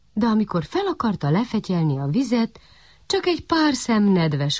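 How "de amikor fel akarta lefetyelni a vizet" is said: ordinary delivery, nothing marked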